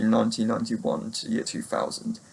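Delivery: usual speaking voice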